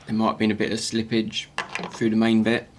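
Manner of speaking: ordinary delivery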